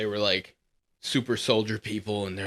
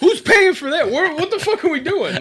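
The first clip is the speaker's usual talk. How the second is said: high, loud, disbelieving; squeaky high what-the-hell; incredulous outburst; 7 dB louder than usual. Real, not acted. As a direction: throw the question up in disbelief. Incredulous rant.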